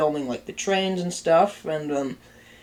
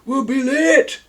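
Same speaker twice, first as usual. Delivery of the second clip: impatient friend; 8 semitones higher, 5 dB louder than usual. Retold or imitated. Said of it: "his friend's like".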